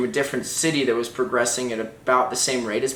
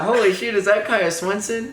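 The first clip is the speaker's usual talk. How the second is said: retold amazement; 8 semitones higher than usual. Boyfriend re-enacting his own tongue-tied greeting, high astonished blurt. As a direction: pitch it way up in laughing disbelief. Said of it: "that's all I could get out".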